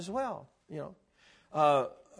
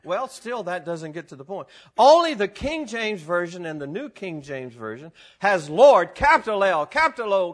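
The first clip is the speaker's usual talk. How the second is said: ordinary delivery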